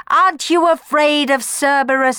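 the Furies scream a warning at Orpheus; high, loud taunting scream. A narrator's reading. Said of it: "screamed the Furies".

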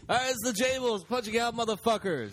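high, loud pumped-up shout-out; loud excited show outro; hyped sign-off. Real, not acted. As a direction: fire up the crowd to close the show.

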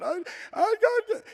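panicked, high and breathless; frantic high babble. Retold or imitated. Daughter at the wreck, babbling high in panic. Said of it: "Heather's going".

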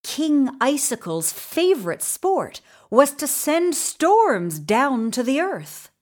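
ordinary delivery, nothing marked

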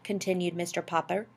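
plain reading voice

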